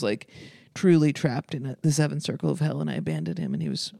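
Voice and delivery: ordinary delivery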